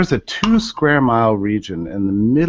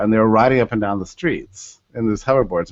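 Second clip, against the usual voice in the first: ordinary talk, nothing marked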